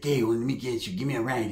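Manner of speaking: low, soft imitated request; hushed cousin mumble; cousin's put-on voice. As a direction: ask for a favor quietly as the cousin. Voicing cousin asking a ride.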